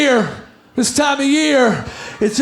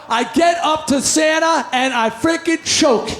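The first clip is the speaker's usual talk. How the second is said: ordinary talk, nothing marked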